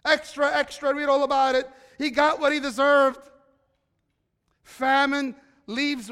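loud newsboy calling headlines; rapid old-time reporter. Pretend. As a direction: shout the news to the street. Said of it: "See, headlines".

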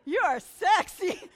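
loud, slow, hammed-up compliment; magic compliment. Complimenting the shy lady by the river, loud and slow. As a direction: belt it out like a grand declaration. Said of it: "I used the magic word".

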